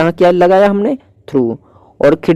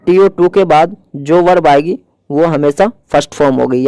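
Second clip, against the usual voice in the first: usual speaking voice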